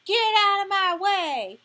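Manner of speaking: high, loud, slow shout; a small boy shouting his lesson at full power; child's yell. Acted. Boy imitates Gilly's yell.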